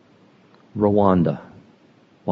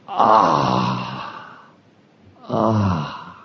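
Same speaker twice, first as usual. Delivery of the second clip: low, aching cry of the open heart; 8 semitones lower than usual. Retold or imitated.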